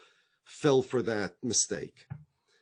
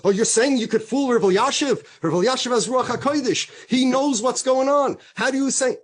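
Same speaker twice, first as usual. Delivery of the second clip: high, loud mock outrage; hammed-up indignant challenge; 9 semitones higher, 7 dB louder than usual. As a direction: act scandalised, voice raised right through. Acted indignant defence of rabbi.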